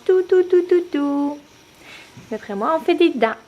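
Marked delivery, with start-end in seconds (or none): 0.0-1.4 tout, tout, tout, aigu et plus fort en chantonnant; répéter en jouant avec le bébé; parler bébé. Real, not acted. Maman joue avec bébé. (maman taquine sa fille, voix aiguë joueuse)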